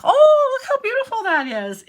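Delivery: very high, very loud gasp of awe; awed delight. Retold or imitated. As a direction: squeal at how beautiful the card is. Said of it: "just like me, they're like".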